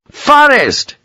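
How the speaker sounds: high, insistent yell; calling out. A narrator's reading. Colonel summoning his major from outside, calling out. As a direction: call the name high and sharp.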